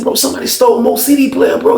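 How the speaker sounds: high-pitched urgent report; urgent news. Retold or imitated. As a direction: break the news to a roommate. Tells roommate urgent news.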